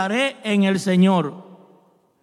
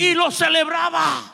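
high, loud retold whoop of celebration; celebratory shout; 8 semitones higher, 4 dB louder than usual. Pastor retelling his fit in church, celebratory shout. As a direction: shout it out high, reliving the moment.

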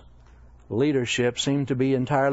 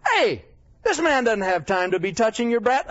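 higher-pitched, self-important gatekeeper; officious apostles; 8 semitones higher than usual. Acted. Apostles turning mothers away from Jesus, officious. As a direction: shoo them away like a busy man's minder. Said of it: "These guys enter in and say".

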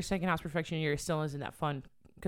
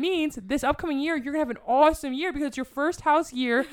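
excited gushing; 9 semitones higher, 6 dB louder than usual. Retold or imitated. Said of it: "I was like".

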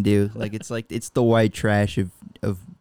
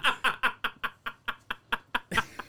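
high wheezing laughing fit; helpless laughter; 11 semitones higher, 10 dB quieter than usual. Real, not acted. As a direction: dissolve into airy laughter. Cracking up after the bit.

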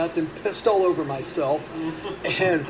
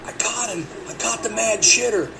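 put-on old man accusing; 4 semitones higher than usual. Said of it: "he points at me and he goes".

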